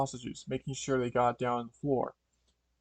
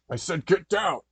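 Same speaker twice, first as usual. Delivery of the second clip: loud, raised-pitch robber's yell; yelled order; 5 semitones higher, 6 dB louder than usual. Acted. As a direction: yell it as a threat. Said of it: "the overheard man in the mask yelled".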